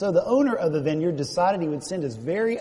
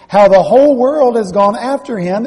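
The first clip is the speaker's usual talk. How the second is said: loud, raised exclamation; 6 semitones higher, 12 dB louder than usual. Acted. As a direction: cry it out, much louder and higher. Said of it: "Exclamation point".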